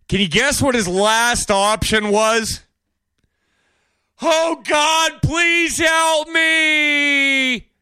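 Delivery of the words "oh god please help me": high, drawn-out mocking plea to God; mock desperate prayer. Mocking a trapped robber begging God, high wail.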